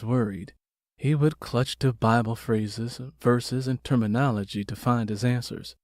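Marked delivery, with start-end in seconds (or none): none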